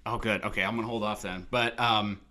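ordinary talk, nothing marked

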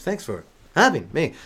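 Shatner impression. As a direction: halt between every phrase. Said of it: "Here he is".

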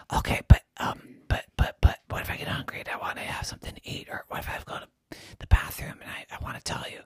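hoarse whisper with no voice; lost voice. Acted. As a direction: try to talk but only air comes out. Jerry voiceless throughout.

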